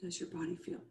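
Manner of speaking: fading soft question; hushed ask. Real, not acted.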